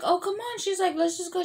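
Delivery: put-on high, pleading voice of the girl; coaxing friend. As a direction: urge her on, pitched high.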